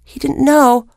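ordinary delivery, nothing marked

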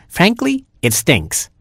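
high-starting Gerbil complaint; disgusted Gerbil. A narrator's reading. Gerbil grumbles about the smelly hole, disgusted. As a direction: squeak out a blunt complaint.

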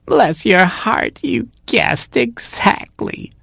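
very high, slow feeble granny; cat posing as grandma. Acted. Cat thanks Robin for food, feeble high granny voice. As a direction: play frail, drawn out up high.

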